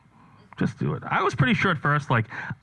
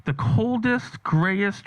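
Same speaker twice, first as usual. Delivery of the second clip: ordinary delivery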